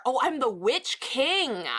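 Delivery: slow, grand Witch King proclamation; mock-ominous Witch King; dramatic announcement. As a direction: announce it like a dark lord. Reads viewer's title dramatically.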